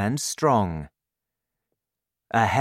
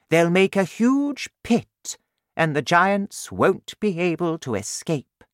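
high, regal queen, louder and sure of herself; confident queen; 9 semitones higher, 4 dB louder than usual. Acted. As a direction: announce the plan like a proud queen. Queen voiced in story.

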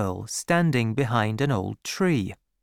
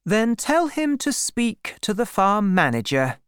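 higher-pitched woman in charge; lady farmer instructing; 8 semitones higher than usual. A narrator's reading. Lady farmer giving orders.

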